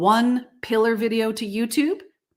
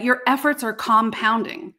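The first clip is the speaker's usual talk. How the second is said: usual speaking voice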